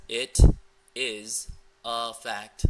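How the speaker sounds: loud, very slow, halting words; one word at a time. Retold or imitated. Coach showing how she texts, loudly word by word. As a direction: leave a gap after each word. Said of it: "typing one message, one word at a time".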